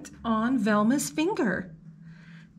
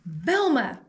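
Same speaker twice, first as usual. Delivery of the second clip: loud call; principal shouting; 2 semitones higher, 5 dB louder than usual. Acted. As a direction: shout her name across the park. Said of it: "shouted Principal Crossley".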